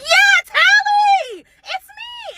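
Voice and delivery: very high, slow, sing-song menace; drawn-out sugary threat; menacing sweetness. Retold or imitated. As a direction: girlfriend catching her boyfriend, sing-song menace.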